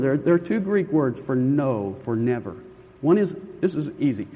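ordinary delivery

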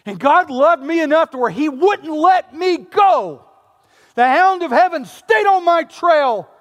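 loud, high, driving sermon voice; fervent preacher voice; full-force preaching. Acted. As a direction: preach it out hard to the room.

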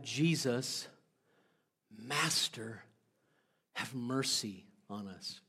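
hushed humble plea for mercy. Acted. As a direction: plead softly, humbled. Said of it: "these guys cry out something different".

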